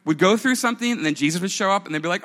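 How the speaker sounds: normal talking voice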